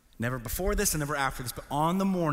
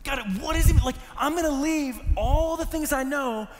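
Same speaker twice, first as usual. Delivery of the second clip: high, drawn-out cries to God; anguished questioning; 8 semitones higher, slower than usual. Retold or imitated. Crying teenager asking God why he must leave, anguished. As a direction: demand answers in pained, high pleading. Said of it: "Asking God all the questions".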